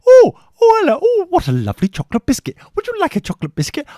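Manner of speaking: high, fast, sugary mock offer; hammed-up sweet-talking scout leader; mock fawning bribe. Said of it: "It's like".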